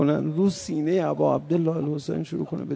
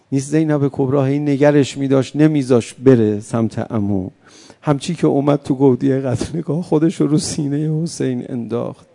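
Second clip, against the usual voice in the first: louder and louder lament, near crying out; mournful preaching; 11 dB louder than usual. Acted. Preacher building the martyrdom story for mourners, loud lament. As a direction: drive the mourning sermon to its peak. Rowzeh climax, full-force preaching.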